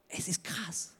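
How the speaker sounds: whispered, stunned by the verse; awed whisper. Real, not acted. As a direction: breathe it out in awe. Awed aside after the verse.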